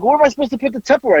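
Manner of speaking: put-on high, fast exasperated kid; mock whine about the Tupperware; imitating daughter. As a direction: rush it up high like a fed-up daughter. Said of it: "She's like".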